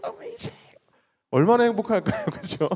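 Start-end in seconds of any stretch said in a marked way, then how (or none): 0.0-0.6 very high, breathy-soft "amazing"; high squealed amazing. Pretend. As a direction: coo it in awe. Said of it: "권사님".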